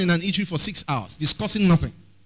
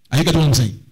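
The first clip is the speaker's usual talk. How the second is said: normal talking voice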